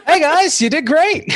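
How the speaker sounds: very high, loud, cackling character voice; high-pitched, manic cheer; gleeful trickster woman. Acted. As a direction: burst out laughing, then shrill loud praise. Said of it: "She's like".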